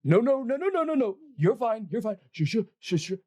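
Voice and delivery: high, fast pleading no's and shoos; calm a spooked animal in a panic; frantic handler. Acted. Handler frantically shooing owlbear off the dwarf, high pitched. GM voices NPC calming owlbear.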